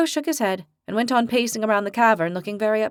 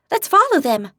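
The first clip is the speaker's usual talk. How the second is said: bright, eager and louder; spring up keen to follow; cheered up; 8 semitones higher, 4 dB louder than usual. Acted. Daphne urging friends to follow the panthers, eager. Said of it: "Daphne cheered up".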